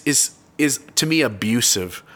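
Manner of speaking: usual speaking voice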